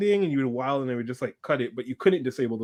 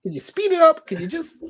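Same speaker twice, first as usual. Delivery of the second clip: high, pushy mock begging; exasperated plea; 7 dB louder than usual. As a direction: plead with a laugh in it. Acted plea to slow driver.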